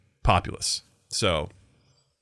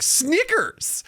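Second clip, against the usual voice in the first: a much higher, playful call of Snickers; fun shout. Retold or imitated. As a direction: sing out the name Snickers way up high. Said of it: "it was a fun way of saying it".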